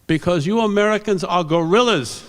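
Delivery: emphatic, high imitated foreign accent; Fred Eng's accent. Teacher scolding his American students, emphatic imitated accent. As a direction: pronounce it as a verdict in your teacher's accent. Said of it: "do the accent".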